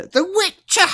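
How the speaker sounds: the other player's way of saying The Witcher; accent mimic. Shown as a demonstration. Teaching how his teammate says The Witcher, in his accent. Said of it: "That's how you say it".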